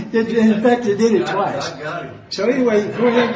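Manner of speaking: real laughter breaking into the words; laughing while talking. Real, not acted. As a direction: struggle on through your laughter. Laughing through the phone story.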